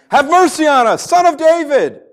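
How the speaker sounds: high, loud cry for mercy; shouting plea. Acted. Two blind men following Jesus down the road, shouting. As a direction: call out desperately to someone walking ahead.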